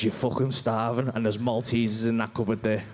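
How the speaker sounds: a deep devilish voice from the back of the head; sly low craving voice, dragged out; impulse control voice. Acted. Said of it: "He goes".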